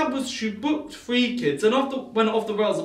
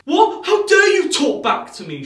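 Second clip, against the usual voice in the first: high loud "What?" then loud scolding; hammed-up furious entitled mum; outraged shriek; 9 semitones higher, 9 dB louder, slower than usual. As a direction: shriek at the host for answering back. Mother outraged at backtalk.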